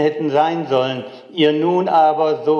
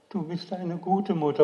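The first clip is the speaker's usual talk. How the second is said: fearful call; 6 semitones higher, 3 dB quieter than usual. Retold or imitated. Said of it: "rufe ich ängstlich hinauf".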